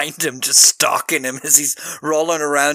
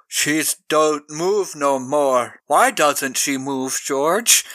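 slow, dopey simple-man voice; slowly, like Lenny not understanding death; Lenny-like simple giant; much slower than usual. Retold or imitated. Podcaster retells the confused giant over Billy in a slow, dopey voice. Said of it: "and he's like".

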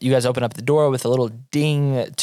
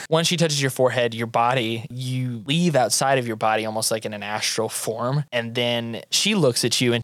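usual speaking voice

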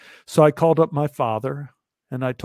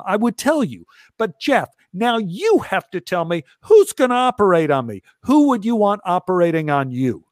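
exasperated outburst; 6 semitones higher than usual. Retold or imitated. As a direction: hurl it back at the doctor in disbelief. Exasperated rant at the doctor.

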